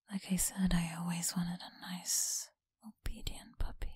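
teasing close whisper; breathy whisper to a listener. Acted. Whispered tease.

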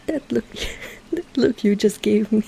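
words broken up by giggles; teasing, breaking into laughter; amused laughing. Acted. Laughing at the captain's reaction.

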